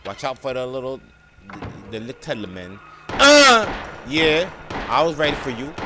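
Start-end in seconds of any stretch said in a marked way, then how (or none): 3.1-3.7 high, loud attack cry; sudden forceful yell; effort grunt. Real, not acted. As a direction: shout as you strike. Attacks the little man.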